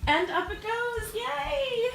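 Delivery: high delighted cheer as the pump starts; excited squeal. Real, not acted. Fishkeeper cheering at her new pump, excited squeal. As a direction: squeal with joy at the water moving.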